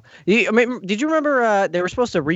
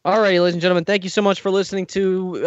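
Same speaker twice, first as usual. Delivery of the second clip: ordinary delivery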